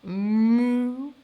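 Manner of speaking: long, lowing moo; drawn-out cow call. Acted. Cow answers the witch.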